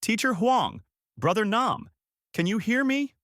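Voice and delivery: high-pitched pleading call; shouting. A narrator's reading. Lan calling to the dead at the grave, high shout. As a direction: call out high, rising on the question.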